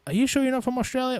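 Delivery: high-pitched, quick mocking question; mock dumb American. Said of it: "these dumb Americans that tell me".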